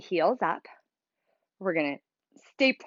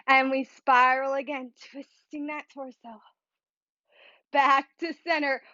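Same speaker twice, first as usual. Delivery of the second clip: ordinary talk, nothing marked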